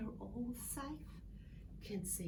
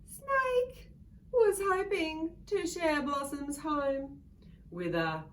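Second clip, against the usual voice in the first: much higher, louder announcement; dramatic reveal; 6 semitones higher, 6 dB louder than usual. A narrator's reading. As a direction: make Snake sound scary. Announcing the snake.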